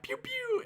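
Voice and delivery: high-pitched toy gun "pew pew"; mock gun shots. Storyteller imitating firing a Nerf gun, playful high sound effect.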